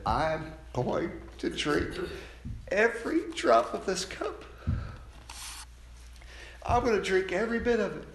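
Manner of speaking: rising, deliberate resolve as Jesus; voicing Jesus.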